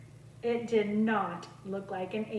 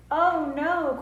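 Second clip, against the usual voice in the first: dismayed cry; 6 semitones higher, 4 dB louder than usual. A narrator's reading. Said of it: "cried Joan and Bob".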